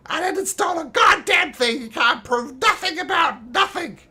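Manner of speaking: high, loud goblin protesting innocence. Acted. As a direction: squawk denials like a cornered goblin. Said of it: "this little goblin tied to the post says".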